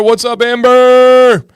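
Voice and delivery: loud, drawn-out hype greeting; stretched hype hello; excited shout-out. Real, not acted. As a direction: call her name out big. Hyped livestream shout-out.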